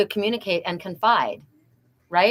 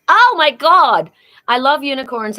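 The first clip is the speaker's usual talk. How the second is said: excited; 6 semitones higher, 10 dB louder than usual. Real, not acted. Thrilled at the new name, a loud high exclamation. Thrilled at being called Pegasus.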